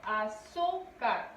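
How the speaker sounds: "Azúcar" slowly in separate syllables, ZÚ held; a... ZÚ... car, falling at the end of "Azúcar"; stressed middle syllable. Shown as a demonstration. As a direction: stretch the ZÚ in "Azúcar". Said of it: "Su sílaba tónica recae en".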